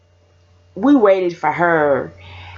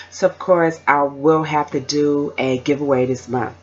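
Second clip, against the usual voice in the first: ordinary delivery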